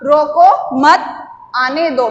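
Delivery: group रोको with मत, break before आने दो; pause after मत. Shown as a demonstration. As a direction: demonstrate the comma after मत. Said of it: "मतलब रोको मत".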